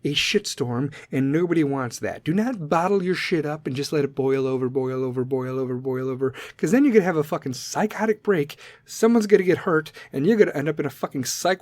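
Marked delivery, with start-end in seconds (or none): 4.7-6.3 softer each time, like an echo dying away; repeated echo, quieter and quieter; fading echo. Pretend. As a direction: let it trail off as an echo.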